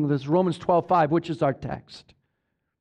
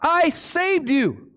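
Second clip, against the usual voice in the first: voicing Christ; 9 semitones higher than usual. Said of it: "he's saying".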